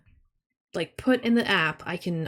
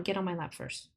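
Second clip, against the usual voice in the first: ordinary talk, nothing marked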